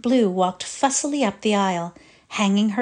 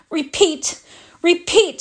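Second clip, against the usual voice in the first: higher, louder teacher's call to repeat; firm command; 9 semitones higher, 3 dB louder than usual. Acted. Miss Meadows orders the girls to sing again, firmly. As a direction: call the class to start again. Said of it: "said Miss Meadows".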